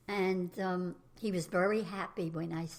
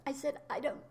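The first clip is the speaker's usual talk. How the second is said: higher, tear-choked voice; teary; 6 semitones higher than usual. Real, not acted. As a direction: speak through rising tears. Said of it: "I'm starting to sound teary".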